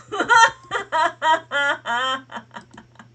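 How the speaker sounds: big helpless laugh; loud burst of laughter. Real, not acted. Setup going horribly wrong.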